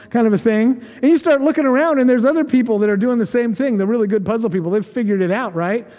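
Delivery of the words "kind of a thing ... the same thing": ordinary talk, nothing marked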